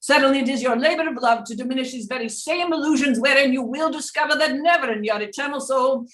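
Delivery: seance medium. Acted. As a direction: speak as a spirit guide through a medium. Channels Doctor Peebles voice.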